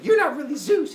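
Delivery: high, loud mock challenge; angry accusation. Semele confronts Zeus at the door, angry. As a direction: throw the accusation at him.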